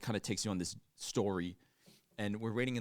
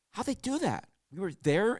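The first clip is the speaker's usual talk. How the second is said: high-pitched baffled question; animated, incredulous retelling; mock astonishment; 10 semitones higher, 4 dB louder than usual. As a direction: imitate someone dumbfounded by a trick. Imitates companion's astonishment at the ride.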